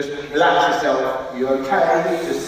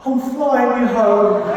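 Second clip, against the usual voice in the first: louder, slow, higher announcement; excited shout; 10 semitones higher, 8 dB louder, slower than usual. Retold or imitated. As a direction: proclaim it with drunk glee.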